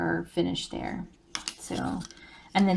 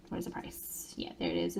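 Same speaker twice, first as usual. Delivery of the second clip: under her breath, checking the tag; whispered aside; 13 dB quieter than usual. Real, not acted. Crafter checking the price sticker, whispered to herself. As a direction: murmur it to yourself as you look.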